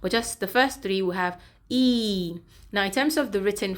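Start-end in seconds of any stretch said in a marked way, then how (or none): 1.7-2.4 "E" drawn out slowly as ee; teacher's slow demonstration of a long "E"; long E sound. Shown as a demonstration. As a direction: hold the vowel in "E" long. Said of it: "it is a long sound".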